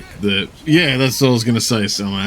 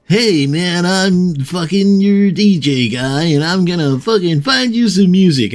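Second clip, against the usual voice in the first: higher, over-eager robotic DJ; creepy AI DJ voice; 8 semitones higher, 4 dB louder than usual. Retold or imitated. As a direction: play a not-quite-human radio bot. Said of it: "And he was like".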